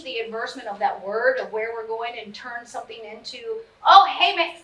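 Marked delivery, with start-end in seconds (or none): 3.9-4.6 cheery hey. Retold or imitated. Parent voicing cheerful greeting.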